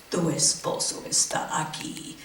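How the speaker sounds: sinister whisper, very soft; villain whisper. Retold or imitated. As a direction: hiss it like a movie villain. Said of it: "woman at the top would go".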